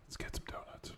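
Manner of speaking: hushed, voiceless whisper; whispered suggestion. Pretend. Tempting the wired night-shift cop after work, whispered. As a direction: whisper it like a secret plan.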